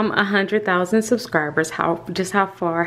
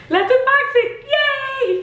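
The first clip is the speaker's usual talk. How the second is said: much higher, squealing yay with real delight; excited squeal; 4 dB louder than usual. Real, not acted. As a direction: squeal with joy before opening the box. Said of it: "I'm so excited".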